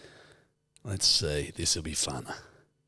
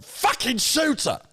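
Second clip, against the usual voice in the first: Alfie from Peaky Blinders; 14 semitones higher, slower than usual. Retold or imitated. Doing Alfie from Peaky Blinders at a friend's request. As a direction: bark it slowly like Alfie. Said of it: "do Alfie for me again from Peaky Blinders".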